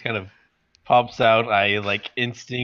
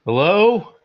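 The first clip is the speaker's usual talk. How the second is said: higher and louder, calling down a hallway; 7 semitones higher, 8 dB louder than usual. Acted. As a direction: call into the dark hallway, unsure. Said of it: "you're kind of calling".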